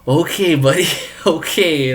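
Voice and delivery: loud, amused brush-off with a laugh; a mocking okay buddy through laughter. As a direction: laugh him off loudly. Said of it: "I'm like".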